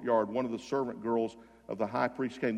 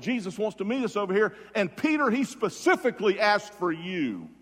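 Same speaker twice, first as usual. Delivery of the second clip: high, louder, eager delivery; excited news-bearer; 8 semitones higher, 5 dB louder than usual. Retold or imitated. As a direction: build eagerly toward the personal message. Disciples relay good news to Peter.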